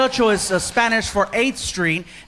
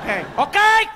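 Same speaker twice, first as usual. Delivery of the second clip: mock nervous okay; 10 semitones higher than usual. The comic answering the hovering waiter, high and nervous. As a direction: squeak the okays out anxiously. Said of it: "I'm like".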